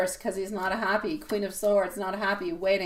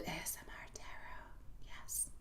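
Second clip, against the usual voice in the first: ASMR whisper; 4 semitones lower, 23 dB quieter, slower than usual. Pretend. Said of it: "ASMR tarot".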